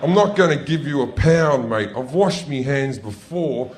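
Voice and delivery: mock-outraged self-defence, stretching the key words; indignant rant. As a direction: argue back loudly at the attendant. Acted rant at toilet attendant.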